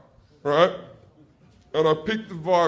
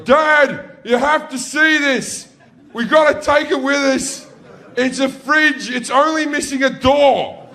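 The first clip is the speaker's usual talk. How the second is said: put-on high, loud child's excitement; excited kid calling out; 11 semitones higher, 6 dB louder than usual. Kid on a junk pile begging Dad to look, excited shouting. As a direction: shout like a thrilled little boy to Dad.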